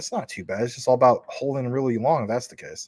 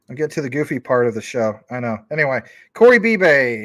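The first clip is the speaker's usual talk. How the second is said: usual speaking voice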